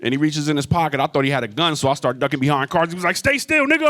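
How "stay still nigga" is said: high-pitched urgent command; urgent order. Retold or imitated. Dealer ordering a ducking buyer to freeze, high urgent mock yell. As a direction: throw it out high and sudden.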